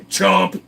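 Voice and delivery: loud chomp effect; loud mock horse bite. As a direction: snap it like a bite. Horse biting sound.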